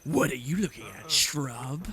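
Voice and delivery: a hint of a gruff thug; lightly voiced tough, growling threats; tough brawler. Player voicing a pit fighter threatening a shrub, lightly gruff.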